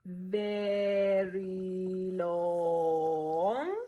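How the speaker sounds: very long, held sustained syllables; drawn-out long note. Shown as a demonstration. Teacher demonstrating a long note, held long. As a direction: stretch each word out like a whole note. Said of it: "On the timeline, this will look like".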